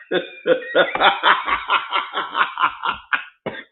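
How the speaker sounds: helpless extended cackle; long laugh. Real, not acted. Laughing at the diagnosis joke, long laugh. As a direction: laugh on and on, breathily.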